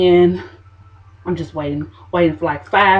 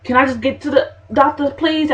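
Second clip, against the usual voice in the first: pleading; 7 semitones higher than usual. Retold or imitated. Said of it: "I'm like".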